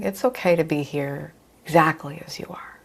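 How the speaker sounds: gentle reassuring whisper; soft spa whisper. Real, not acted. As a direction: whisper it softly and kindly. Reassuring students in meditation.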